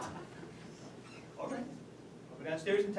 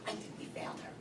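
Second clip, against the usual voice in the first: whispered, barely audible; hushed despairing admission; defeated whisper; 14 dB quieter, faster than usual. Acted. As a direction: let it out in defeat. Defeated after daughter won't open.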